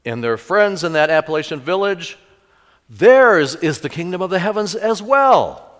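loud, high, ringing proclamation; preaching climax. Acted. Pastor proclaiming the blessing to his congregation, full force. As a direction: build it up and declare it to the church.

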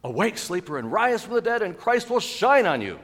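high, loud call, building; full-voiced pulpit summons; rousing proclamation. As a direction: wake the sleeper with a ringing cry. Preacher proclaims the wake-up call.

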